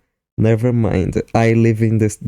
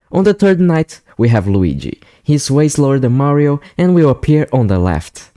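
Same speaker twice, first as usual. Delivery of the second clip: voiceover narration; 3 semitones higher, faster than usual. Acted. Scripted voiceover explaining game mechanics.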